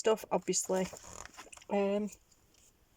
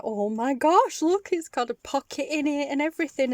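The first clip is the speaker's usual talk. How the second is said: excited; 7 semitones higher, 6 dB louder than usual. Retold or imitated. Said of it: "I was like".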